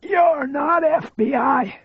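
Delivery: high, slow, defiant voice of a frail man; frail man's high, brave retort; gallant defiance. A narrator's reading. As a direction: stand firm though your voice is weak and high. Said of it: "he said gallantly".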